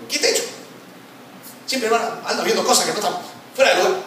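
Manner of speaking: high, quick mock-indignant retort; acted angry denial. Husband answering wife who asked if angry, mock-angry retort. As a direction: play the touchy husband, voice jumping up. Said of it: "estás recontra enojado".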